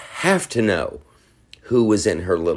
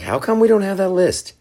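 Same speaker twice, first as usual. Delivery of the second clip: high, indignant mock outrage; high-pitched incredulous demand; quoting a UFC fighter; 6 semitones higher than usual. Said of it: "He's like".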